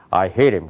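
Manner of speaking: "I hate him" run together, low in the throat; American "I hate him" as one low run; American-style English. Shown as a demonstration. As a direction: drop "I hate him" low and link the words. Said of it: "미국 사람은 이렇게 얘기하는 거".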